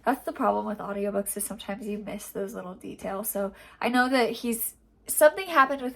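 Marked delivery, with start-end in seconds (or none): none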